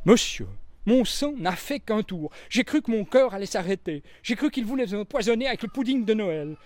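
high, timid little maid's voice; young maid. A narrator's reading. Annie the young maid explaining herself to Poirot, high and timid. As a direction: speak up shyly but earnestly.

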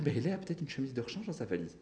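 put-on high, soft old-lady voice; soothing grandmother. A narrator's reading. Grandmother excusing his missing shirt, soothing. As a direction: reassure him softly, pitched high. Grand-mère rassure poliment le désaxé.

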